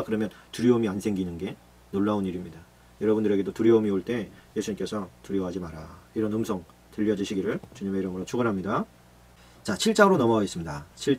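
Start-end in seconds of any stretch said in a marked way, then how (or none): 5.2-6.0 lower, much softer comfort; soft reassurance. Acted. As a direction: say it gently, almost hushed. Jesus comforts listeners. (두려움에 빠진 이들에게 예수님이 낮고 부드럽게 건네는 말)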